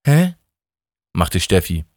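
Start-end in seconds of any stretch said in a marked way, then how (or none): 0.1-0.4 confused huh. Acted. Steffi confused.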